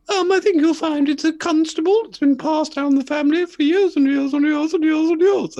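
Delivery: very high, loud falsetto woman; proud owner gushing in falsetto; woman on Antiques Roadshow. Acted. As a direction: put on a piercingly high lady's voice. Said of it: "And she says".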